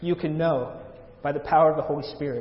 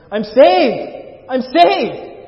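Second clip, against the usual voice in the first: high, very loud cry of revelation; acted outburst of a man overcome; overcome exclamation; 9 semitones higher, 11 dB louder, much slower than usual. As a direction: shout it out high, overwhelmed by sudden realization. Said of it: "he starts to weep".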